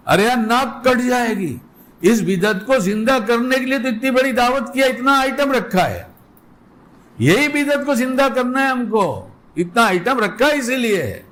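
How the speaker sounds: a hint of an offended host guarding his pride; indignant host.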